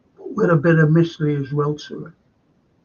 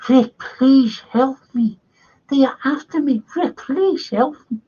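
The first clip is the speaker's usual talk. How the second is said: a desperate little man pleading for rescue, pitched way up; Peter Lorre voice; 9 semitones higher than usual. Retold or imitated. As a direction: beg Rick for help in a much higher, frightened put-on voice. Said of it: "Who liked Peter Lorre?".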